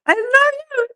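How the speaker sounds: very high, thin squeak; squeaky affection. Real, not acted. Speaker apologizing to Ollie, squeaking affectionately.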